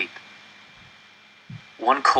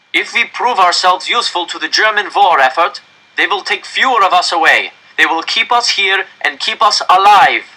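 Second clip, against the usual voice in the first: director's raised, higher public address; stern official; 9 semitones higher, 6 dB louder than usual. Acted. Director warning the crowd into the microphone, raised and stern. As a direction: command the whole square from the microphone.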